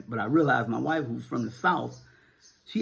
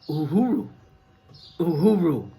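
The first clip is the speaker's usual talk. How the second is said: louder, raised rallying call; called-out sign-off; 4 semitones higher, 7 dB louder than usual. Real, not acted. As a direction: call it out like a slogan, higher and louder. Calls out sign-off word.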